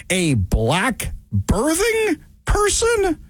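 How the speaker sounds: high, slow, drawn-out disbelief; slow, high mocking disbelief; incredulous mockery. Real, not acted. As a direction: stretch it out like the phrase is absurd. Mocking the phrase.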